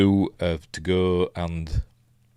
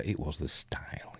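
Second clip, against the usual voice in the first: whispering; 5 semitones lower, 14 dB quieter than usual. Real, not acted. Reading a chat comment aloud.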